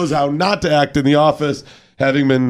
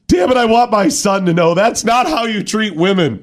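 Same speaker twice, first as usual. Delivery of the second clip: mock passionate outburst; 8 semitones higher than usual.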